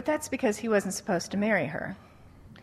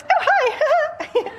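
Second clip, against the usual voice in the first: very high, breathy-sweet swoon; swooning girls; 5 dB louder than usual. Retold or imitated. Girls greet the handsome Australian, swooning high. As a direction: flutter it like a smitten girl. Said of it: "girls are like".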